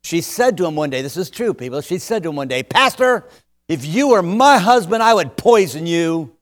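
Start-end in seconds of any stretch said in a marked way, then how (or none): 2.6-6.4 much higher, louder threat; frustrated wife. Retold or imitated. Frustrated controlling wife snaps at the pastor, high and loud. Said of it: "she got so frustrated".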